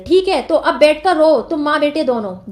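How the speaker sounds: normal talking voice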